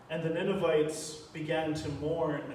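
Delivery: normal talking voice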